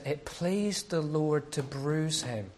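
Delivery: slow, solemn reading of "It pleased the Lord to bruise him."; scripture reading. Acted. Preacher reading Isaiah to the church, slow and solemn. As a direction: read it slowly like holy writ.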